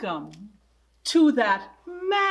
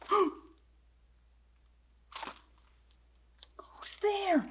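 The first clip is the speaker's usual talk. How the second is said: higher, hushed gasping who's there; a startled, higher hushed question; 6 semitones higher, 9 dB quieter than usual. Acted. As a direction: gasp at the unexpected voice. Gasp at a strange sound.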